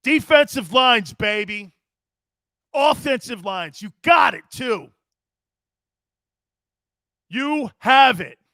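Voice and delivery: real fired-up holler, words stretched out slowly; loud, drawn-out hollering. Real, not acted. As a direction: holler it in short, slow, punched bursts. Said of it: "I don't know why I'm hollering".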